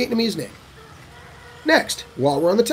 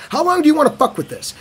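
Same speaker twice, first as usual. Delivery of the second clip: exasperated question; 7 semitones higher, faster than usual. Real, not acted.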